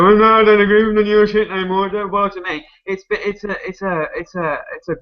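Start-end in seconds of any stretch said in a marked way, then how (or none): 0.0-2.7 mock defiant refusal. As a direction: play someone petulantly opting out, pitched up. Acting out a refusal to comply.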